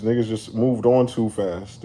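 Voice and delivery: ordinary talk, nothing marked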